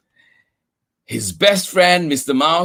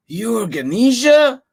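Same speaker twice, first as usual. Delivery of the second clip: higher, louder surprised question; exclaimed; 9 semitones higher, 4 dB louder than usual. Acted. Old man surprised to meet Ganesha. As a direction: exclaim it in amazement. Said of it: "the old man exclaimed".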